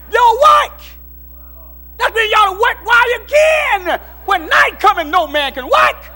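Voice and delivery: high, loud preacher's shout; shouted preaching. Acted. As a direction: holler it to the congregation. Closing exhortation, shouting.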